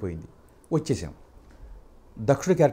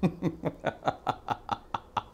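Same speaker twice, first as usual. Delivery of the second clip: scornful laugh; 14 dB quieter than usual. Shown as a demonstration. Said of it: "Ramarao గారిది దానవీర స్వర్గంలో dialogue".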